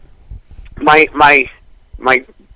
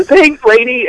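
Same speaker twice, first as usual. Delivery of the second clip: put-on higher kid voice; lightly voiced little boy; boy thanking the mom, lightly voiced; 12 semitones higher, slower than usual. Kid at yard sale.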